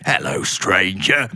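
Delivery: slow, low, shady trader; Resident Evil merchant. Acted. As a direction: drag it out low like a sinister game vendor. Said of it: "literally talks like this".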